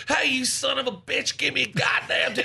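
high, irate heckler imitation; mock angry yell. Snubbed fan cursing for a t-shirt, mock angry yell. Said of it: "screaming and yelling at me".

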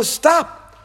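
shout. Acted. Angel's call repeated.

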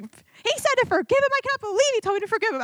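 high, fast, incredulous retelling of her anger; indignant outburst. Retold or imitated. Venting to Preston after leaving mad, high indignant rant. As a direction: re-enact fuming disbelief, pitch shooting up. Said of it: "I left mad".

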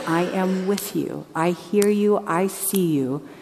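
a hushed, slow divine promise; God's tender voice. Acted. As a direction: speak as God comforting his child, very slowly. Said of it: "God is telling us".

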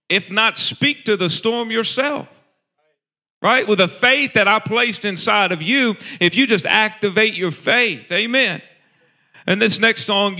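loud, high, driving preacher cry; preaching at full force. Acted. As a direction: rise up and shout the truth over them.

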